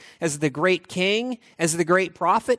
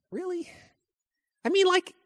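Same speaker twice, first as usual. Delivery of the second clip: incredulous bride; 12 semitones higher than usual. Acted. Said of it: "the bride are like".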